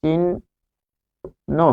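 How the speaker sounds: চিহ্ন very slowly as "chin ... no"; split syllables. Shown as a demonstration. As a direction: break চিহ্ন after a held n, softly. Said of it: "ঠিক এরকম ভাবে হবে". (চিহ্ন ভেঙে ধীরে চিন্ ... নো)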